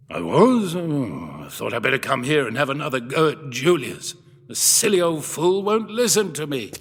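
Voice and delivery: lightly voiced old professor, mildly surprised then grumbling; absent-minded old professor. Professor to his daughter about the museum owner, mildly grumbling. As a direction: vague old scholar, a touch huffy.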